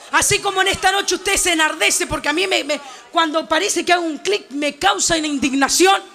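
ordinary talk, nothing marked

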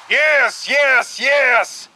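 high, loud repeated cries; frenzied shout. A narrator's reading. Maddened passenger answering the narrator, shouting. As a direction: shout each word in a separate burst. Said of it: "Cornelius shouted".

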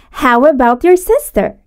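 ordinary delivery

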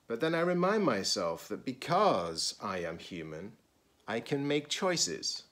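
expressive picture-book read-aloud; reading to children. Acted. Teacher reading a picture book to students, expressive read-aloud. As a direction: read it slowly to a class of children.

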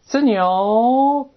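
high, rising creak of a door; imitated door creak. Shown as a demonstration. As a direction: mimic a door creaking slowly shut. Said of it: "这么一声就关上了". (拟声：门滋扭一响)

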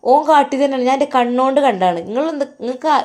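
high, loud furious retort at the husband; angry shout. Acted. Wife quarrels with husband. (ദേഷ്യത്തോടെ ഉറക്കെ)

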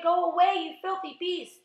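loud, high shout at a foe; high, outraged yell. A narrator's reading. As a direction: shout it high, stretching the last words. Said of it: "they shouted".

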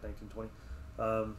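usual speaking voice

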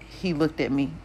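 plain reading voice

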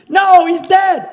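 high, loud, slow drawn-out retort; imitated outburst. Man at altar answering about forgiving his father, loud imitated protest. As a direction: cry it out in protest.

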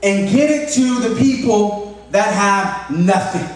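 lightly voiced earnest kid; child voice. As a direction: daughter explaining how to end hunger. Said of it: "And she goes".

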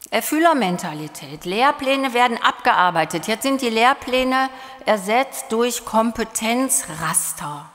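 ordinary talk

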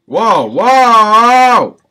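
high, loud outraged yell; raging gamer. Real, not acted. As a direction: yell in disbelief at the glitch. Outraged at game glitch.

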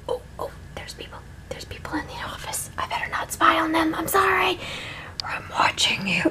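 hushed whisper while peeking out. Real, not acted. Whispering while spying on the office next door. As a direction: whisper so the office people won't hear.

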